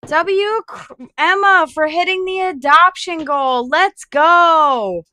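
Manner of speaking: excited cheer. Real, not acted. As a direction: cheer a viewer for hitting the goal. Streamer cheers donation goal reached.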